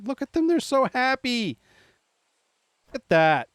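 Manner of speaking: high, adoring coo; touched, pitched well up. Real, not acted. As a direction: gush over them in a high voice. Cooing over photo of friends.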